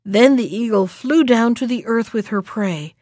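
usual speaking voice

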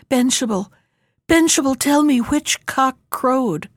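usual speaking voice

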